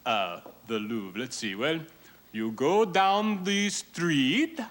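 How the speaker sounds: put-on slow, halting French-accented English; fake French accent. Fake Frenchman giving a tourist directions, slow halting French accent. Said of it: "I'm just a poor Frenchman".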